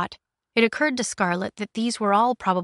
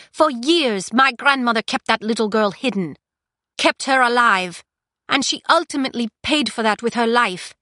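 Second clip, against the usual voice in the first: put-on higher, louder defiant young woman; defiant prisoner; 5 semitones higher, 5 dB louder than usual. Prisoner confronting the Queen, raised defiant voice.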